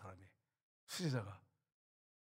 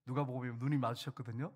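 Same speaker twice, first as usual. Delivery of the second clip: normal talking voice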